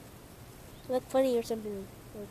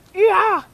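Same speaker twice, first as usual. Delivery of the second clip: loud high cheer; 8 semitones higher, 15 dB louder than usual. Real, not acted. Owner cheering the dog for the ball, loud high cheer. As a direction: whoop it out big.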